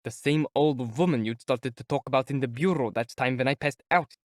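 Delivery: plain reading voice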